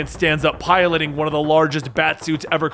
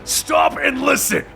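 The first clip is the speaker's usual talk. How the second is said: higher commanding call; 6 semitones higher than usual. A narrator's reading. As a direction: cut through the fight with it. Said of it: "bursting through the league".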